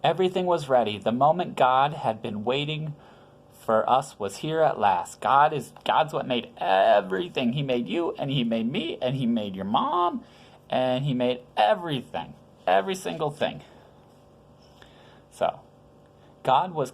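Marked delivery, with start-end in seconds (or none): none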